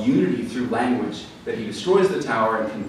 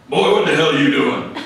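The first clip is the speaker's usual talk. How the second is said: loud Southern-dad bellow, pitched up; Southern accent; 4 semitones higher, 8 dB louder than usual. Retold or imitated. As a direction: boom it out like a blustery Southern father. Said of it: "have that kind of Southern accent".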